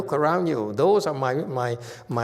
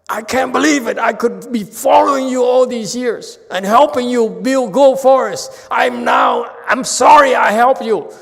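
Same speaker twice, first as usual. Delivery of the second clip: much higher and louder furious shout; angry outburst; 11 semitones higher, 11 dB louder than usual. Retold or imitated. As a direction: yell it in betrayed anger. Disciple angrily storms off.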